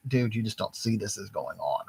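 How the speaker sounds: soft, mildly pitying imitation; hushed touch of acted pity. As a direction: say it quietly, as if sorry for a clueless friend. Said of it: "maybe you feel pity for him".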